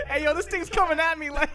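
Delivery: high, loud comic alarm; hammed-up fright, voice jumping up; mock panic. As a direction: cry out as if charged, half laughing. Acts being charged by bear.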